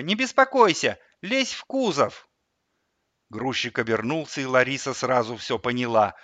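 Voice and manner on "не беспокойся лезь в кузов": put-on high-pitched boy, drawn-out ending; child voice. A narrator's reading. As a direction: lure mum in with a small boy's voice. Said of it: "Он говорил в телефон детским голосом". (детский голос)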